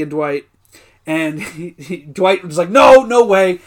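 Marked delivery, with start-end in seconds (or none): 2.7-3.6 loud, higher-pitched mock objection; emphatic refusal. Podcaster retelling a coworker's refusal, loud mock protest. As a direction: act out an indignant protest, loudly. Acting out Dwight's angry refusal.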